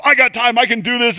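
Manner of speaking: high, loud burst of super excitement; over-the-top eager. Acted. Super excited recruit wants to lead, over-the-top eagerness. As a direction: overdo the eagerness like a keen volunteer. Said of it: "They're over the top".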